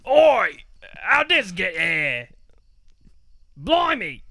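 put-on high, loud cockney-style British accent. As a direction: shout it out as a Brit in a pub. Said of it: "A British accent".

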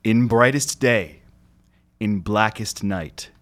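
slow, grand heroic oath; solemn oath recitation. Pretend. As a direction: declaim it like a superhero vow. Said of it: "I figured I would just recite this".

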